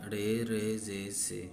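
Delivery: ordinary talk, nothing marked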